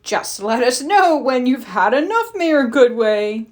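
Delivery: a hint of a chuckling villain; smug taunt. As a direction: needle the rival mayor. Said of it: "chuckled Mayor Humdinger".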